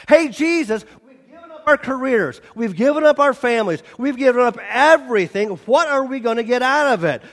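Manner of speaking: high, fast, indignant complaint from Peter; complaining Peter. Acted. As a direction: whine it fast to Jesus like a fed-up disciple. Preacher voicing Peter's complaint.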